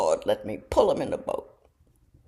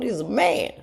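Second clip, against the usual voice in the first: ordinary delivery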